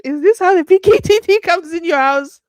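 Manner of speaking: high, loud panicky question; alarmed disbelief. Real, not acted. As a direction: exclaim it loudly in spooked disbelief. Spooked, fears intruders at home.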